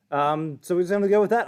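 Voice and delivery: ordinary delivery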